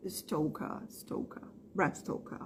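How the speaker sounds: softly whispered, no R at the end; whispered British Stoker. Shown as a demonstration. Teacher whispering the British Stoker to students. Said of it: "eles puxam a última vogal".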